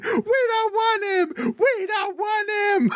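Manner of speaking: shrill high mock scream, laughing; high-pitched screaming. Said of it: "[name] screaming".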